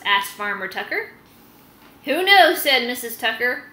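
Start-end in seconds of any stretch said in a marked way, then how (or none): 2.0-2.6 loud, high shrug of a reply; brisk farm-wife retort. Acted. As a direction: toss it off loudly. Missus Tucker's quick retort.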